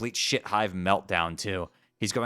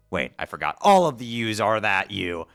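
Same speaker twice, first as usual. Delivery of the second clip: usual speaking voice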